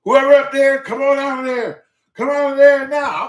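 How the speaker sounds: loud, high commanding yell from the street; retold cop ordering a suspect out, shouting; police shouting orders. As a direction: bark it like a cop calling up the stairs. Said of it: "You said".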